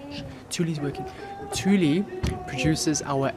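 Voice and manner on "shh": soft whispered intro; whispering. Real, not acted. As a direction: whisper it close to the mic. Sneaking up, whispering.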